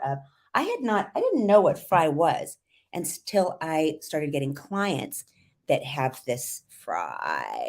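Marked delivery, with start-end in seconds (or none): 5.7-7.7 low creaky fry on the words; vocal fry. Shown as a demonstration. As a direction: drop into a lazy creak. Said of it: "You hear what that is?".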